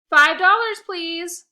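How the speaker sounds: host demanding donations, loud; loud money demand. Acted. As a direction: call for cash over the noise.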